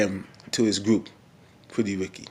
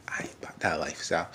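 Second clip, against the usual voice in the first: laughing aside; 5 semitones lower, 12 dB quieter, faster than usual. Real, not acted.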